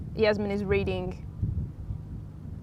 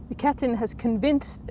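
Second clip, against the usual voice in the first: ordinary delivery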